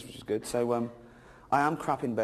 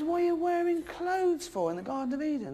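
outraged God. Acted. Comic voicing God scolding Adam and Eve, high indignant squeal. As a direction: squeal it like a scandalised deity. God voiced in retold Genesis.

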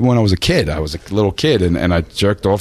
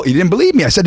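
usual speaking voice

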